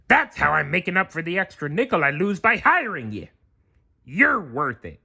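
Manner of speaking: high, fast stingy crab voice; Mister Krabs. Acted. Mister Krabs justifying the pay toilet to SpongeBob, fast. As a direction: rattle it off like a penny-pinching boss.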